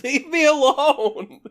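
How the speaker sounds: loud, slow, high yell at the dog. Real, not acted. Yelling at his dog biting his wrist, loud and slow. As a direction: drag it out, fed up.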